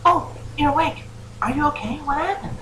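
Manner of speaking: high, concerned little pony voice; worried caregiver greeting a patient in a high voice; Fluttershy. Acted. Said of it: "spots the eyes and goes".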